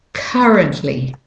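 currently with British or Australian "uh", falling; British or Australian take on currently, no R in CUR; British or Australian accent. Shown as a demonstration. Teacher contrasting accents, British or Australian accent on currently. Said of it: "the U says ah".

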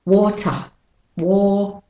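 British Australian "water", no R at the end; British Australian pronunciation. Shown as a demonstration. Teacher repeats water for learners, British Australian accent. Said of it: "British Australian first".

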